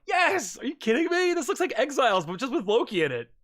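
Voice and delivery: high, thrilled outburst; excited fan reaction. Retold or imitated.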